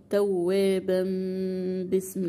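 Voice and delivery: "توابا" with tanween held as a hummed meem; iqlab when joining. Shown as a demonstration. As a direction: slowly recite "توابا" ending in a long "-bam" into "باسم". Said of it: "لو بتوصلي برضو".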